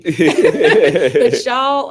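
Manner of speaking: laughing through the words. Real, not acted. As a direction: laugh while you praise them. Laughing while praising team.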